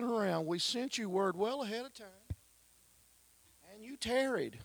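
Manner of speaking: high, accusing sister's voice; Mary blaming Jesus. Acted. Grieving Mary accuses Jesus, high and sharp. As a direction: hurl the blame upward at him. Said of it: "How's that for a greeting?".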